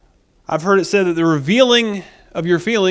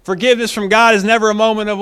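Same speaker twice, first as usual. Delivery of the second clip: ordinary delivery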